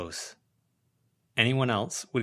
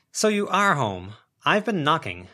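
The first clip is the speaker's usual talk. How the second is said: brisk neighbour voice; 8 semitones higher, 6 dB louder than usual. Acted. Sharon voiced, higher and louder.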